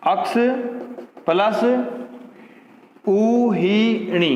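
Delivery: उहिणी stretched very slowly, sound by sound; slow dictation. Shown as a demonstration. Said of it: "अक्ष plus उहिणी को देखो आप". (उहिणी बहुत धीरे बोलकर)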